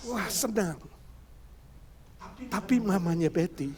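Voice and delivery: ordinary talk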